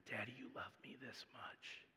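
put-on soft breathy whisper of a small boy; child's whisper. Dad voicing his son at bedtime, whispering. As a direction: whisper it like a sleepy toddler. Three-year-old showing love with arms.

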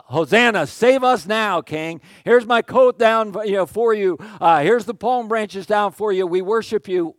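loud, high cry of praise; worshipping crowd. Retold or imitated.

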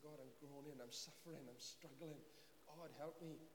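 soft, hushed groaning prayer; groaning lament. Retold or imitated. David pouring out his lament to God, softly groaning. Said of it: "he's groaning".

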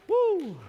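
sudden high joyous woo; exultant high whoop. Real, not acted. Preacher celebrating the climax with a high whoop. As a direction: let out a celebration cry.

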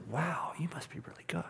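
awed hushed whisper. Retold or imitated. Friend praising his Russian, whispered. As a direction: murmur it in quiet awe.

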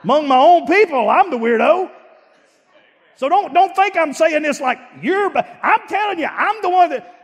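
high, loud preacher's cry about being the odd one; fired-up preacher voice; full-force preaching. Acted.

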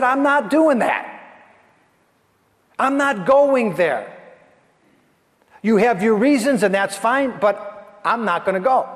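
defiant Jonah. Acted. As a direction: dig in your heels. Jonah refusing God.

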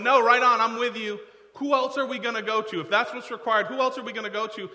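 loud, high, all-in pledge of loyalty; eager Peter. Acted. Peter pledging to follow Jesus whatever the cost, loud and eager. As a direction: jump in loudly with total commitment. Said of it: "And Peter's going".

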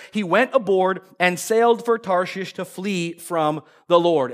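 normal talking voice

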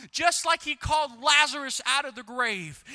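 ordinary delivery

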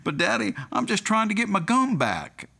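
high-pitched, protesting toddler imitation; little girl. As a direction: plead like a small child to Daddy. Toddler answers her dad.

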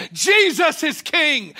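loud, high, slow shout of Jesus is King; shouted proclamation. Acted. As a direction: proclaim it, hitting Jesus hard.

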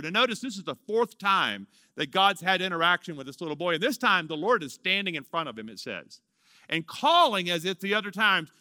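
normal talking voice

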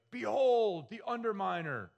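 booming villain's yell; high, loud supervillain proclamation; the Underminer. Acted. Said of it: "and he yells".

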